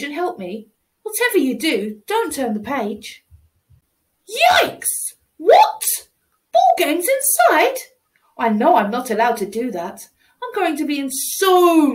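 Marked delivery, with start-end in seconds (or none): 4.3-5.1 sudden high yelp of shock; high shocked yikes; startled yelp. Acted. Child shocked at new mess. 5.4-7.8 shocked disbelief. Acted. Child shocked at new mess.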